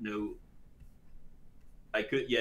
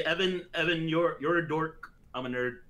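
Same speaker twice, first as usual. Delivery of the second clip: normal talking voice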